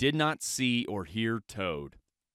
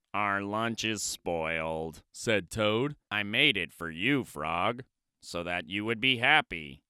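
sad Toad; 6 semitones lower, 4 dB quieter than usual. Acted. Toad telling Frog lunch is spoiled, sad.

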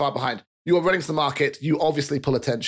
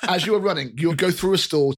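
ordinary talk

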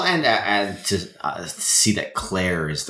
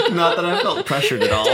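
high, giggling denial; laughing; 14 semitones higher than usual. Real, not acted. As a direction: laugh through the joke. Laughing at her own joke.

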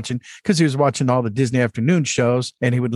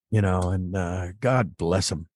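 choked up; 7 semitones lower, 6 dB quieter, slower than usual. Real, not acted. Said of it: "I just started crying".

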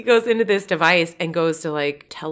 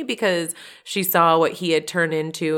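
ordinary talk

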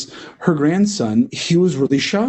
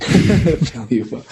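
Yoda voice; much slower than usual. Pretend. Yoda inverted syntax.

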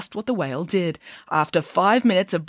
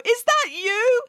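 high, slow call; high mother's voice calling; slower than usual. A narrator's reading. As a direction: call across the water to him. Mother calls from dock.

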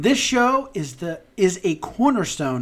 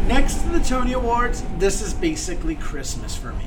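excited. Acted. Eager theatregoer arrives.

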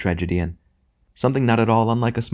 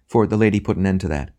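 ordinary delivery

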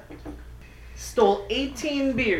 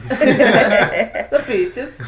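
laughing, louder; 2 semitones higher, 9 dB louder than usual. Real, not acted. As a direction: laugh through the words. Laughing repeat.

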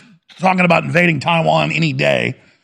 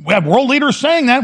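ordinary talk

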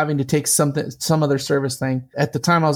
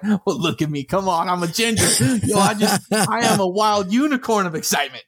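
mock boast; 4 semitones higher than usual. Boasting of his excitement.

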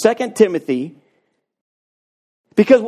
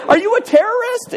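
very high laughing accusation; mock alarm, laughing. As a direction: squeak it out like a startled lady. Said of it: "You know".

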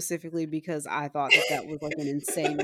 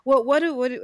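ordinary talk, nothing marked

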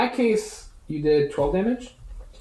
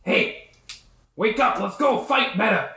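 higher-pitched urging call; Grung giving orders; 4 semitones higher than usual. Acted. Game master as the Grung rousing the bullywug, urgent call. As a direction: rouse a slacking fighter with a raised voice. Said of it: "and it's like".

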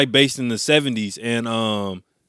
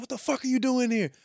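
high mock freaked-out friend; shocked friend; 11 semitones higher, 6 dB quieter than usual. Retelling to friends, a shocked buddy asks why he came, high mock voice. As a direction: pitch it way up, disbelieving.

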